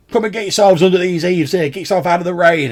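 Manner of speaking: high, loud call to make yourself heard; shouting over rain. Acted. Ferryman calls the travellers in under the eaves, shouting. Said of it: "he shouts to make himself heard".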